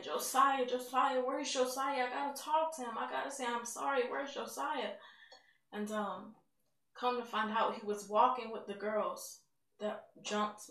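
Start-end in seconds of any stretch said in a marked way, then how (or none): 0.0-4.9 put-on concussed girl screaming for her boyfriend; retold frantic screaming. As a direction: cry out for him, frantic and dazed. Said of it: "all the time I'm just screaming".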